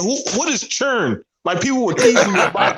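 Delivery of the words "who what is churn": mock teasing friend. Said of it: "He always be saying".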